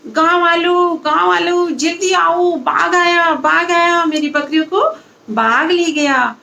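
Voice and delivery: high calling shout for the villagers; shouting for help. Acted. Boy on the tree calls the villagers, high shout. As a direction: yell out a fake alarm from the tree. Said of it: "तो वहां से चिल्लाता है".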